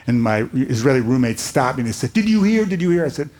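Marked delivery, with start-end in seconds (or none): 2.1-3.0 imitating a roommate's breathless excitement; excited news. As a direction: blurt it out like you can't hold it in. Roommate bursting with Entebbe news.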